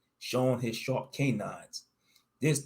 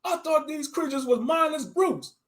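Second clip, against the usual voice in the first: high, amazed voice; stunned disbelief; shocked. Acted. Narrator voicing shocked self.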